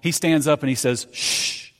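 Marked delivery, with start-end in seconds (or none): 1.1-1.7 long, unvoiced "shh"; calming shush. Jesus shushing the wind and waves, calming hush. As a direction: hush the storm with one breath. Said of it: "He calms the wind and the waves with his voice".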